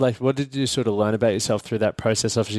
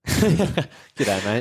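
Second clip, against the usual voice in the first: put-on laughing Aussie accent; 2 semitones higher than usual. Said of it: "understand the Australian accent".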